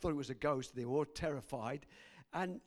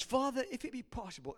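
higher appeal to a father; pleading prayer; 6 semitones higher, faster than usual. Acted. Jesus praying in the garden.

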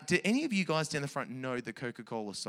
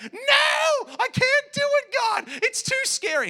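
squeaky high mock whine; scared believer; 7 dB louder, slower than usual. Pastor acting a scared believer answering God, high mock whine. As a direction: whine like a frightened kid. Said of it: "you're gonna be like".

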